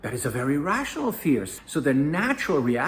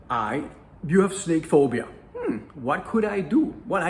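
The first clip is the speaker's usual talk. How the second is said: ordinary delivery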